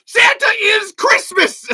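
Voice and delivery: high, slow, shouted insistence; emphatic shout. Real, not acted. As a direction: hammer it home at full volume. Heated rant about Santa.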